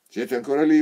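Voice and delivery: loud, high furious yell; sergeant shouting. A narrator's reading. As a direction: scream it across the courtyard. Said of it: "urlò il sergente".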